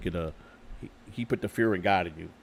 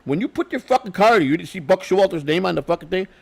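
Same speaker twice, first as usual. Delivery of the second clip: higher and louder, scolding yell; retold angry shout; 8 semitones higher, 5 dB louder than usual. Clubhouse guy yelling at the manager about the parking spot, loud. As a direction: relive telling off the visiting manager.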